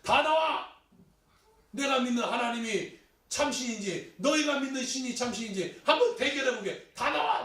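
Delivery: loud, high-pitched acted battle cry; challenging shout. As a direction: call the enemy out to a showdown. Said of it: "쫓아 들어가서 소리쳐요".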